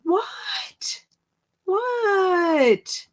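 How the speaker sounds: high crying What, rising then falling; shocked disbelief. Real, not acted. A host finding her comment erased, high shocked cry. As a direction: squeal it out in disbelief.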